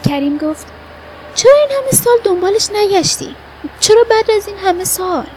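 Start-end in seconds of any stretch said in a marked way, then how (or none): 1.3-5.3 high, loud, insistent questions; acted reproachful outburst; challenging questioning. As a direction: demand an explanation with raised voice. Friend challenges him over years of not searching.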